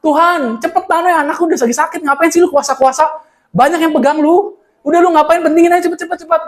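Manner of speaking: a desperate father pleading with Jesus to hurry; impatient pleading. Acted. Jairus voiced urging Jesus to hurry.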